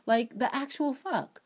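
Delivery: ordinary delivery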